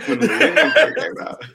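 high, loud, laughing through the words. Real, not acted. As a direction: try to speak through a big laugh. Laughing while repeating the remark.

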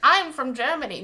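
hammed-up upper-class accent; Thurston Howell. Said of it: "Nope, that sounds too British".

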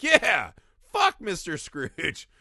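loud high "Yeah", then slow "fuck Mister Scrooge"; gleeful agreement. Retold or imitated. Speaker cheering Piggy's line while watching, gleeful. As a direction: cheer along with Piggy, drawing it out. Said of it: "And I was like".

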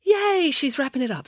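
high-pitched little yay; gleeful cheer. A narrator's reading. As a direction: cheer with relief that it's ending. Loretta glad speech is ending.

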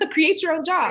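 high, loud laughing punchline; tossing it out with a laugh; laughing emphasis. Real, not acted.

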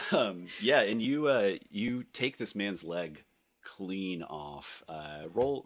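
ordinary talk, nothing marked

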